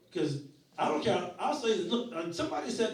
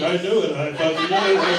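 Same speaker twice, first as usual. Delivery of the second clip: angry dad; 10 dB louder than usual. Retold or imitated. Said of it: "He's like".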